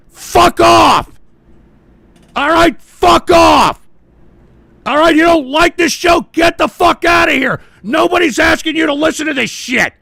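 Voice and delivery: loud, high-pitched angry yelling; furious shout. Real, not acted. Host telling immature listeners to leave, furious shouting. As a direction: yell them out of the room.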